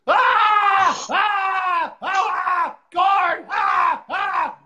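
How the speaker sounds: loud, high-pitched repeated screams; screaming inmate. Retold or imitated. Crazy prisoners in the next cell, screaming nonstop. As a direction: scream wildly and call for the guard. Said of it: "I can hear the crazy people screaming".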